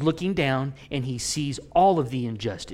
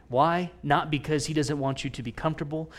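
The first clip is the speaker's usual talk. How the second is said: normal talking voice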